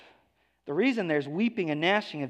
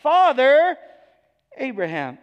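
high strained call on "Father", then falling away; rich man crying out; 8 semitones higher, 8 dB louder than usual. Acted. Rich man in Hades calling to Abraham, high pleading cry. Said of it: "which is why he cries out".

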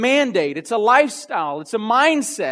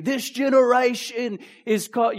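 put-on Australian accent; 3 semitones higher than usual. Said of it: "a good Australian saying".

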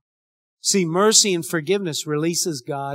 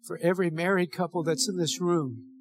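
pastoral prayer. Acted. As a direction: pray over the couples gently. Leads the closing prayer.

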